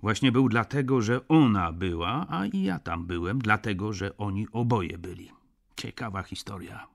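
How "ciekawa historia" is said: muttered. A narrator's reading. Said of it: "mruknął doktor".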